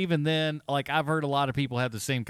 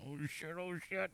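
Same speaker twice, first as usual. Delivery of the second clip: very soft, higher-pitched fretting; mock nervous panic; 4 semitones higher, 16 dB quieter than usual. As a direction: imitate a tense viewer panicking under the breath. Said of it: "holding on to your seat going".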